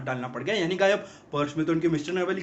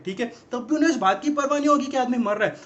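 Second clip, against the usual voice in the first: ordinary delivery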